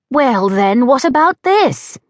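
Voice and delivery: a hint of a stately English grandmother; dignified British gran. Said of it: "a much more dignified, definitely British older woman's voice".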